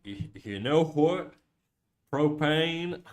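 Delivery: lightly voiced drawling cartoon Texan; halting attempt at a cartoon dad's drawl; Hank Hill. Streamer attempting a requested cartoon voice, tentative impression. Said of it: "How would Hank Hill go?".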